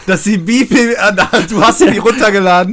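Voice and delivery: high, loud excited talk. Real, not acted. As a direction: bubble over with excitement. Excited about a discovery.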